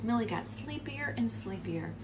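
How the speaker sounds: ordinary delivery, nothing marked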